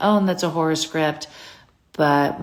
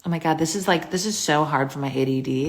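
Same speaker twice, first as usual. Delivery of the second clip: normal talking voice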